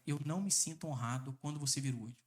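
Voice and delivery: low, soft, gentle tone; calm soft statement. Shown as a demonstration. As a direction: say it quietly without pushing. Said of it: "Mostre pra ela".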